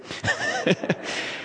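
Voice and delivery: soft, high chuckle; quiet laugh at your own story. Real, not acted. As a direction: let out a soft, breathy laugh. Laughs at his own anecdote.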